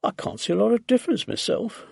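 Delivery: offhand, lightly voiced reply; casual answer. A narrator's reading. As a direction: toss it off casually. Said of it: "had answered casually".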